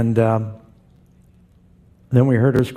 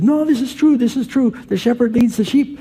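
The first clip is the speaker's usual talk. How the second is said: higher-pitched Israeli shepherd voice; Israeli accent; 11 semitones higher than usual. Retold or imitated. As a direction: imitate an insistent Israeli man, pitched up. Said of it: "Israeli accent".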